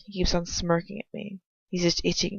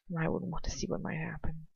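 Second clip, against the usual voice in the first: breathy whispered confession; 7 dB quieter than usual. Acted. Captive whispers his secret wish to himself.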